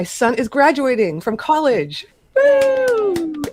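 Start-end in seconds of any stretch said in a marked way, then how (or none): 2.3-3.4 cheer. Real, not acted. Son graduating from college.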